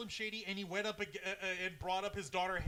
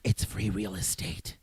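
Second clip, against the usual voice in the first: deep meme voice; 11 semitones lower, 5 dB louder than usual. Retold or imitated. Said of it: "meme quote".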